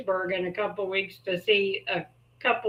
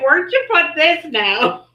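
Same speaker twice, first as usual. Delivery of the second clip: laughing through the words; 5 semitones higher, 6 dB louder than usual. Real, not acted. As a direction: laughs while quoting, louder. Laughing while quoting Dan.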